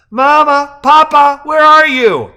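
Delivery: put-on very high, loud child calling for his parents; a little boy waking and calling loudly for his parents. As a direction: shout from the next room like a small boy looking for Mama and Papa.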